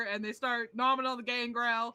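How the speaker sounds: usual speaking voice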